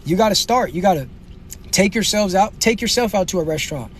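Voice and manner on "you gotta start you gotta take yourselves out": normal talking voice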